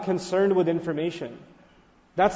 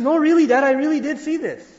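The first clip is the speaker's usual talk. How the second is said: higher and louder, pleading to be believed; a young boy begging his dad to believe him; a child insisting to his father, high and urgent; insistent child; 6 semitones higher, 4 dB louder than usual. Retold or imitated. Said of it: "And so he says".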